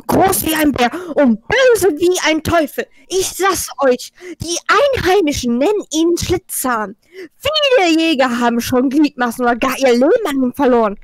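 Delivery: usual speaking voice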